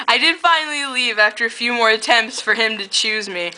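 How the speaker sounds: plain reading voice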